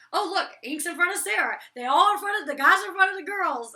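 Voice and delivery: high, loud tattling imitation; mock bickering. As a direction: imitate someone protesting loudly mid-squabble. Acts out [name] arguing back.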